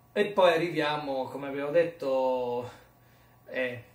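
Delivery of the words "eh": ordinary talk, nothing marked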